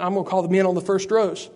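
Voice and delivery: usual speaking voice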